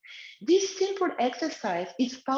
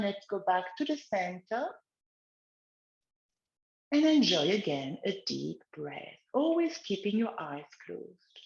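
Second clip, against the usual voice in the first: guided relaxation; 3 semitones lower than usual. Acted. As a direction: lead gently, eyes closed. Leads a guided neck exercise.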